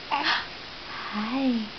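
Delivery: usual speaking voice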